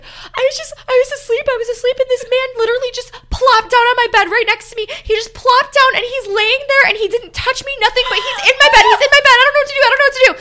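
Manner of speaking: high, loud, breathless babbling; imitating a terrified roommate pouring it all out; frantic panic. Roommate explains the man in her bed, in high loud panic. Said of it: "screaming and crying".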